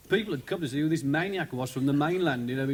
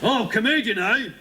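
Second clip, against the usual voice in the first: sarcastic, louder and higher; mocking copper; 5 semitones higher, 5 dB louder than usual. Policeman mocks the cheeky prisoner, sarcastic and loud. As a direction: sneer it like a fed-up cop.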